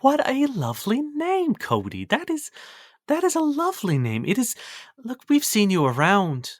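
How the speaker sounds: high, gentle and kind; tender cook. Acted. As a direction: soothe the boy warmly. Isabella comforting Cody.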